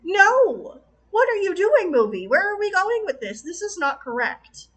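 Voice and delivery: high, loud exasperated protest at the screen; indignant disbelief. Retold or imitated. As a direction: scold the movie like it betrayed you. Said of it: "I'm sitting here going".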